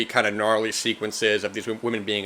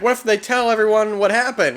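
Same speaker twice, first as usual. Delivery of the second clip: higher lightly voiced fretting sister; a hint of a worried sister, pitched up; panicked sister; 11 semitones higher, 3 dB louder than usual. One sister fearing exposure, lightly voiced high worry. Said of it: "one of them is like".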